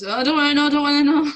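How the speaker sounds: imitated refusal. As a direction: act out not wanting to hear it. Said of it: "And he goes".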